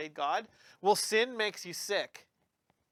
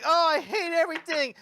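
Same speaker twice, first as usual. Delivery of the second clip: put-on sulky kid; 8 semitones higher than usual. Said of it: "Nemo's like".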